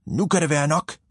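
mock teacher outburst; shouting teacher. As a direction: play the fed-up teacher in the wastebasket. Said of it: "og råber".

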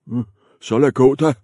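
quick, loud, grumbling troll; troll letting the middle goat pass; grudging troll. Acted. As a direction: wave it off with a grumble. Troll gives in.

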